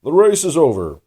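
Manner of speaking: loud dodo call. A narrator's reading. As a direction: announce it loudly to everyone. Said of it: "the dodo suddenly called out".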